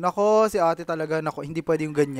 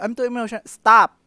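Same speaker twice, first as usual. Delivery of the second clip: high overwhelmed line then a loud stop; mean it and cut it off; serious; 7 semitones higher, 4 dB louder than usual. Shown as a demonstration. Demonstrating the right way to quit a video, hammed-up. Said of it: "Dapat pag".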